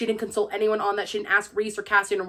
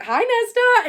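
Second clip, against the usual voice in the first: chirpy greeting; 12 semitones higher, 6 dB louder than usual. Retold or imitated. Bryce greeting Nesta brightly.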